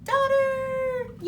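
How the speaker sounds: high, loud big announcement; excited reveal. Real, not acted. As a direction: sell the reveal with a cheer.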